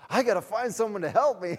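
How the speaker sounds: high, loud flustered cry for help; mock panic. Preacher imitating a flustered volunteer needing help, mock panic. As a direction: squeal it out in comic alarm. Panicked new volunteer after convert says yes.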